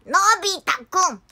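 high, loud robot-cat cartoon voice; cartoon catchphrase impression. Cartoon helper announcing a gadget to Nobita, loud and high. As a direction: belt out the gadget name in a cartoon voice.

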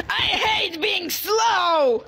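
Shrimpo. Acted. Angry shrimp hating being slow, hammed-up furious shout. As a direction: rage slowly and theatrically. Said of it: "play as this little angry shrimp".

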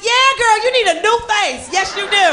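high, loud gushing agreement; eager egging-on. Acted. Female friend urging Botox, emphatic mock encouragement. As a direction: push her into it, loud and high.

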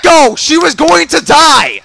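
loud, high indignant yell; protesting shout. Real, not acted. Gamer to Echo over a stolen kill, indignant shout. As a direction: yell the complaint at a teammate.

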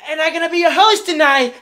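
loud, high, fast clown proclamation; rushed, shouted show opener; excited host announcement. Acted. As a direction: announce yourself gleefully to the whole house.

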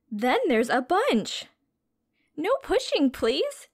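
bright, sing-song storybook read-aloud; reading to children. A narrator's reading. Teacher reading a science picture book to children, storybook voice.

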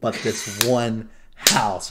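a hammed-up gripe; mock exasperation. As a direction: fake outrage at one ugly house. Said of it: "And I'm all like".

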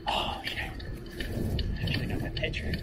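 unvoiced regretful whisper; whispered self-reproach. Real, not acted. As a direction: breathe it to yourself. Regretful aside while filming.

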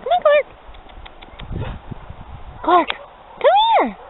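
high calling voice; calling a dog. Real, not acted. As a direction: call the puppy over sweetly.

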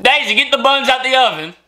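high, playful British accent mimicry; mock British accent. Viewer echoes partner's attempt at the line, hammed-up mock British accent.

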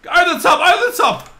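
loud high rising cheers; will it over the top; excited urging. Real, not acted. Urging ball over obstacle.

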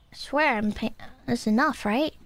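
ordinary talk, nothing marked

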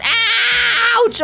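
high yelp easing down into dismay; pained outcry. Real, not acted. Kid blown up in lava again, pained outcry.

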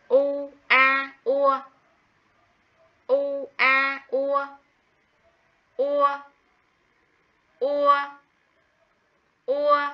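u, a, ua spelled out high and loud, one sound at a time; spelling aloud. Shown as a demonstration. Teacher spells out ua for first graders, high and clear. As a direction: model the spelling slowly for children to copy. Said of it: "Đánh vần".

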